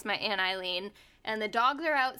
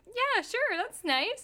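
put-on young self; 11 semitones higher, slower than usual. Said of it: "And I was like".

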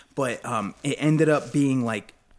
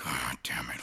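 whispered, much softer and lower grumble; whispered aside; 12 semitones lower, 8 dB quieter than usual. Retold or imitated. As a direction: mutter it under your breath, unvoiced. Caller mutters to himself.

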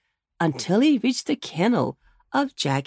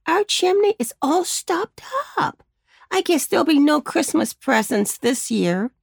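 put-on higher small boy, lightly glum; little boy; 7 semitones higher than usual. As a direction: give up on Christmas. First boy despairs.

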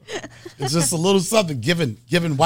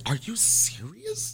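slow, high, softly scandalised question; mock disbelief, drawn out and pitched up; 6 semitones higher, 7 dB quieter, much slower than usual.

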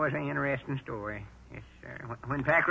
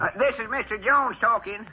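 pitched well up to fool the caller; old man faking a high voice on the phone; high disguise voice; 8 semitones higher than usual. Acted. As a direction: put on a high voice to disguise yourself. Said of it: "I'll use my high voice".

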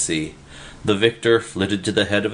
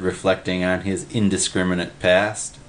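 ordinary delivery

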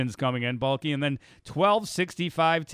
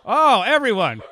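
alarmed exclaim; 8 semitones higher, 10 dB louder than usual. Real, not acted. Co-host choking on air.

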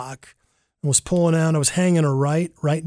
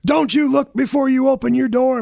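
retold furious yell, pitched up and louder; angry cyclist; 8 semitones higher, 4 dB louder than usual. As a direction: bark it like the cyclist chewing you out. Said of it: "He's livid with me".

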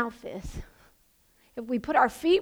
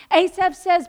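ordinary delivery

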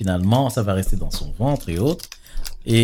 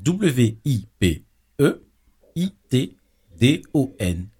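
spelling out; much slower than usual. Shown as a demonstration. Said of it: "spelling it out letter by letter".

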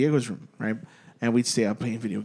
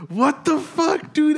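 startled reaction; 14 semitones higher, 4 dB louder, slower than usual. Retold or imitated. Woken by screams, reacting.

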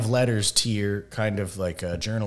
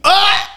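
yelp; 12 dB louder than usual. Pretend. Mock pained yell.

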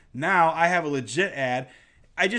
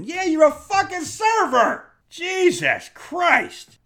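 very high, loud, drawn-out yell; strained, high-pitched outburst; exasperated shout; 12 semitones higher, 5 dB louder, slower than usual. Acted. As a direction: shout it slowly in outraged disbelief. Yells back at imagined offended server.

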